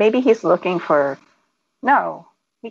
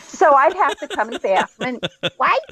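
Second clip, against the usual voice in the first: normal talking voice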